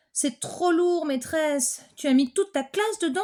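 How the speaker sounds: put-on high, cheeky child voice; child complaining. Boy teases the teacher about her trunk, high child voice. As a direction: grumble about the heavy trunk.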